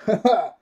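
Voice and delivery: high, loud "ha ha"; imitated cocky laugh, punched out; mock triumphant laugh. Hero landing ready to fight, mock triumphant laugh.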